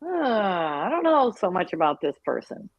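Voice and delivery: imitating someone unsure and put out; retold doubtful player. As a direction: voice a benched player's misgivings. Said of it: "it's like".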